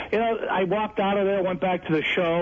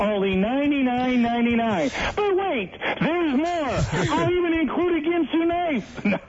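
much higher, big TV sales hype; but wait, there's more energy; infomercial pitchman; 7 semitones higher than usual. Acted. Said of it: "I want you to hawk my space heater".

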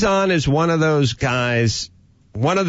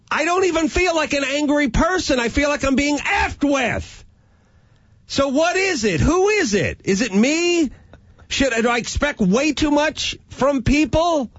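high, strained, exasperated plea; pushed up in pitch, near breaking; frazzled dad venting, voice way up; worked-up rant; 14 semitones higher than usual. Real, not acted. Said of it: "Why are you so angry?".

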